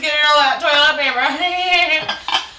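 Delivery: high, loud, drawn-out mocking voice; loud mocking jeer. Resort staff laughing at everyone back home, loud mocking. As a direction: jeer at the losers, loud and slow. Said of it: "We were laughing at everyone".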